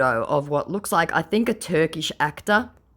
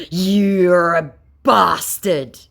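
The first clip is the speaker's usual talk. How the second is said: very slow, louder snarling put-on voice; mock snarl; 5 semitones higher, 8 dB louder, much slower than usual. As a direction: drag out the insult like a growling thug. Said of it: "he snarls at me".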